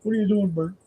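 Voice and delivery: cheery puppet buddy; Ernie impression. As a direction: greets Bert in a high, bubbly muppet voice. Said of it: "Ernie's kinda like that".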